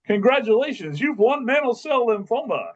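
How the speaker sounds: high, bright booming prize announcement; game show announcer voice. Retold or imitated. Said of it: "I used my best game show announcer voice".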